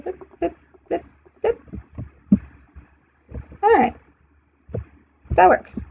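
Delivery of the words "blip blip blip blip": soft, high blips; tiny placing sound effects, pitched up and quiet; little blip noises. Pretend. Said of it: "we're just gonna kind of go".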